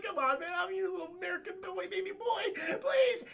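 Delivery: put-on high, whiny baby-voice plea; babyish pleading. Lost man begs his fake dad in a high baby voice. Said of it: "He's like".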